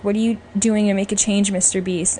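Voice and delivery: ordinary delivery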